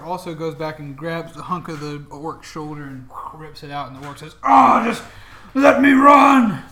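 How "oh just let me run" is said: pleading orc. Acted. Fleeing orc begging.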